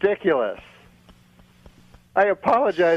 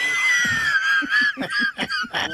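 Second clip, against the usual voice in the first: crowd laughing hard; big laugh from the crowd; audience laughter. Real, not acted.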